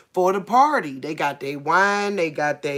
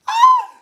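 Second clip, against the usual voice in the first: very high, loud shriek; scared shriek; 10 dB louder than usual. Real, not acted. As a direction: scream in fright. Shriek at mouse.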